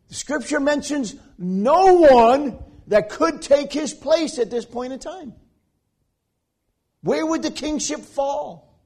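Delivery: loud, high pleading questions; preacher voicing the dying king, urgent; impassioned preaching. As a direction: cry out the king's fear from the pulpit.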